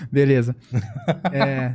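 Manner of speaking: chuckling through the words; laughing. Real, not acted. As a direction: laugh while you talk. Laughs after whisper mix-up.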